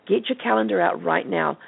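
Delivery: usual speaking voice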